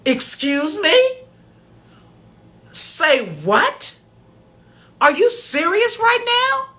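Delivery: high, loud mock outrage; squeaky indignant mimicry; incredulous scammer. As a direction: play the caller's shocked disbelief. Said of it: "He says".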